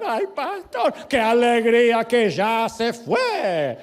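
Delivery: high, loud, drawn-out mock rejoicing; imitated widow. Widow to the pastor at the funeral, hammed-up joy. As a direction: wail the joy out high and slow. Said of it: "Y venía la viuda".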